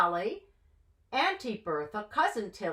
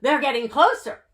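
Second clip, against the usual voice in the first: loud warning; shouting; 4 semitones higher, 9 dB louder, faster than usual. Acted. Mendel shouting a warning to the matzo man, loud. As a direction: call out that they are near. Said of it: "Mendel shouted".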